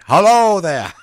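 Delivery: lightly voiced pirate greeting. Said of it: "he thinks he's a pirate".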